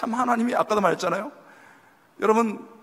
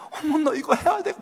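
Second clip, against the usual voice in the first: mock gushing friend; 9 semitones higher than usual. Mimicking the pushy friend.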